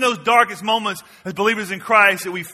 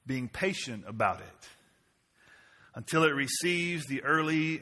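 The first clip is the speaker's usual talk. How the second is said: much softer, lower closing read; soft scripture reading; 7 semitones lower, 10 dB quieter than usual. Acted. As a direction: read it gently, letting it settle. Reading scripture softly to close.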